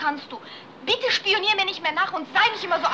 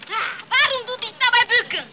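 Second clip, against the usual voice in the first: loud, higher suspicious question; jealous accusation; 6 semitones higher, 6 dB louder than usual. Acted. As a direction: press him, suspicious and loud. Jealous wife keeps grilling. (laut und misstrauisch)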